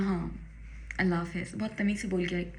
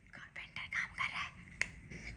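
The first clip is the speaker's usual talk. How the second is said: hushed whisper so the carpenter won't hear; 18 dB quieter, slower than usual. Real, not acted. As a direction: drop to a secret whisper.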